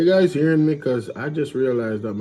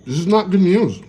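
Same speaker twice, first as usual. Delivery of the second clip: higher, louder mocking of the excited anchor, "not" rising; 6 semitones higher, 4 dB louder than usual. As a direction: parrot her upbeat tone back sarcastically. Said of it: "Why are you so excited".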